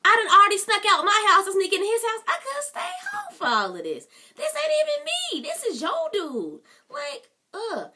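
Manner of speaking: aggravated. Retold or imitated. A friend fed up with sneaking into the boyfriend's house, aggravated. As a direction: vent like you're fed up with all of it. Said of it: "Aggravation level is at a freaking ten".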